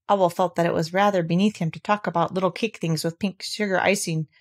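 usual speaking voice

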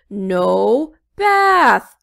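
loud monster roar; booming no from the monster. A narrator's reading. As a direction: bellow it like a beast. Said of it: "roared the monster".

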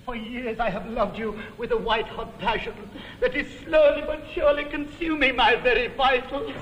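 very fast, breathless torrent of passion; mock-ardent suitor gabbling his passion; gushing declaration. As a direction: rattle off the love speech in a hammed-up rush. Hammed-up rapid love declaration.

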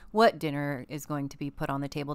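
ordinary talk

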